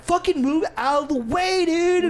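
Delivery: high-pitched annoyed order; retold griping at a dog in the way; exasperated gamer rant. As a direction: pitch climbing in frustration. Retold ranting at game companion.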